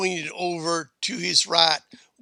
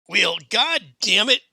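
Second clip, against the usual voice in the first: raised, louder burst of annoyance; exasperated cursing; 5 semitones higher, 5 dB louder than usual. A narrator's reading. Mayor fed up with interruptions, loudly exasperated.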